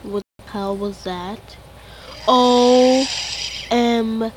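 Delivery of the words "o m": loud, startled burst on the letter; startled shout. Real, not acted. Gamer suddenly spotting the ghost, startled loud shout. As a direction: jolt out the letter in shock.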